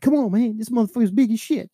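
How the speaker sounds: high-pitched griping imitation; straining retold complaint. Removal guy struggling at the bottom of the stairs, exasperated. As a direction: imitate a worker complaining under a heavy load.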